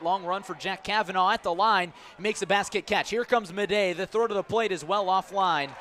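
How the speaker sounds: urgent play-by-play burst; excited play call. Real, not acted. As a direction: ride the play as it unfolds. Sac fly and throw home.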